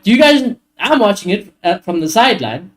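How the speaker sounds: hammed-up manager telling off his team at halftime; ranting manager. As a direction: tell the squad off, building to a high-pitched scold. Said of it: "I'd be like".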